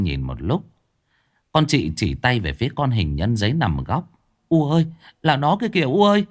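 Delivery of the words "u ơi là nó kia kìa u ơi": fast, high scared pointing cry; hurried fearful cry to mother; frightened girl. Acted. (hốt hoảng chỉ tay)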